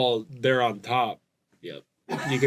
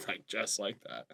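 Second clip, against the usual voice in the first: ordinary talk, nothing marked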